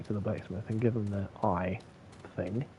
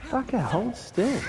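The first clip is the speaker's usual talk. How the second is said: much higher, slow and strained; frustrated at enemy; 13 semitones higher, slower than usual. Real, not acted. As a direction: yell at the dodging enemy. Swearing at a game enemy.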